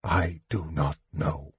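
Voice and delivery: very soft, low and weary; hushed shrug. A narrator's reading. Mage shrugging to Tanis about the others, hushed and low. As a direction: let it drop, quiet and indifferent. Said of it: "he shrugged".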